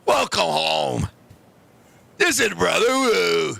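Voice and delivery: low, slow, booming kung fu master voice; deep, drawn-out mock Shaolin greeting; mock kung fu monk. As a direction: play a solemn Shaolin monk from a martial arts film. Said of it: "I was like".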